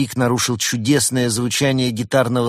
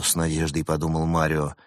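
ordinary delivery, nothing marked

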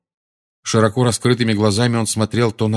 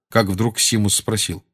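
plain reading voice

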